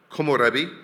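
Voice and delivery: Komorebi said loud, each syllable careful; Komorebi, carefully sounded out; Japanese word. Shown as a demonstration. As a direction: present Komorebi with care. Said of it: "possibly incorrectly pronounced".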